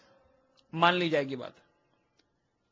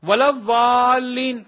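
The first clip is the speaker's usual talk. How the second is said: drawn-out, louder Arabic recitation; prayer recitation; 5 dB louder, much slower than usual. Acted. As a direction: stretch the long vowel as in prayer. Demonstrates a doubtful prayer recitation. (نماز کی قرأت، کھینچ کر)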